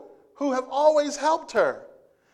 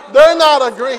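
loud; 2 semitones higher, 14 dB louder than usual. Real, not acted. Laughing at the acted scene.

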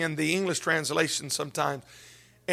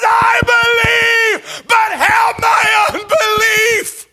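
high, loud, tearful scream; crying scream; 13 dB louder, slower than usual. Acted. Father screaming his plea to Jesus through tears. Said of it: "he screams out, croaking with tears".

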